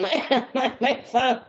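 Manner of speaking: laughter breaking up the words; laughing. Real, not acted. Host cracking up at a guest's accent attempt, laughing. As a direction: talk through giggles.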